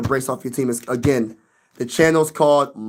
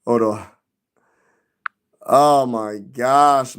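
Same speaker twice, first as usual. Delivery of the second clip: ordinary delivery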